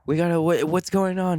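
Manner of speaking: high, anxious mock plea; relieved urgency. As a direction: act out her relief and urge him to leave. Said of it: "like relieved, kinda like".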